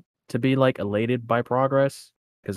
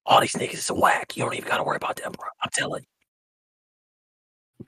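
insistent hushed whisper; conspiratorial whisper; faster than usual. Acted. Dealer telling a friend to ignore everyone, insistent whisper. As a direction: whisper firmly, like sharing inside information. Secret pep talk as a dealer.

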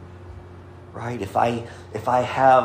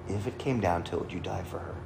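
soft, lowered voice on the question; hushed repeat; 3 semitones lower, 8 dB quieter, faster than usual. Retold or imitated. Pastor putting a grave question to the groom, hushed. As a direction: let the question drop away quietly.

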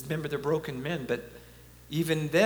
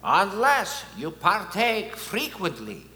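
put-on heavy Polish accent, higher, slow and louder; John Paul II accent; 5 semitones higher, 5 dB louder than usual. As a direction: quote him in a slow, solemn Polish accent. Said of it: "how can I not use his accent?".

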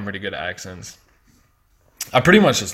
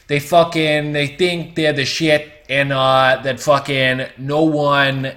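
higher, louder, slow mock Jersey Shore swagger; mock New Jersey accent; 5 semitones higher, 5 dB louder, slower than usual. Mocking Jersey Shore guys to his listeners, higher and louder, a hammed-up cocky drawl. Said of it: "They talk like this".

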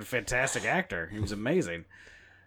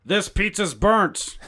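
slow, much louder gruff Ford; Harrison Ford impression; 8 semitones higher, 10 dB louder, slower than usual. Shown as a demonstration. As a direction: complain like an action hero. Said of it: "You can do anything as Harrison Ford".

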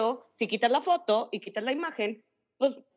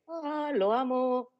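dreamy swoon; much slower than usual. Retold or imitated. Swooning over flowers.

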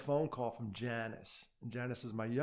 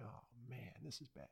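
much softer, almost whispered dread; hushed dread; 18 dB quieter than usual. Retold or imitated. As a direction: murmur it, barely audible. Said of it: "I'm like".

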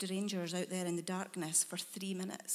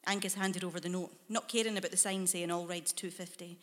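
plain reading voice

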